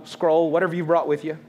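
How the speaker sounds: ordinary talk, nothing marked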